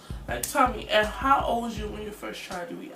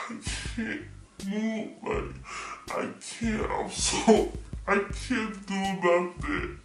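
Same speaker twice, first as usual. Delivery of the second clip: dazed and numb; slower than usual. Retold or imitated. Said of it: "I'm just sitting there like".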